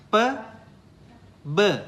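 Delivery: P with breath, B voiced; P and B contrast. Shown as a demonstration. Teacher repeating P and B for a student. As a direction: set P against B. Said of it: "You hear the same sound".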